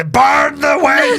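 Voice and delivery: hammed-up shouted condemnation; exaggerated rallying shout; mock mob cry. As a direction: yell it like a baying mob. Mob cry in retold scene.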